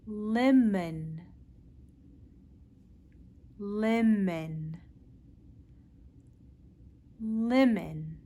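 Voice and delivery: lemon, L clear and slow; teacher repeating lemon; modelled L word. Shown as a demonstration. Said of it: "Practice with me".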